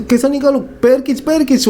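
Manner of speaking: high pitched frantic orders; panicked shout. Retold or imitated. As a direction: yell it in a panic, pitch up hard. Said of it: "वो चिल्लाता है". (घबराहट में ऊँची चीख़)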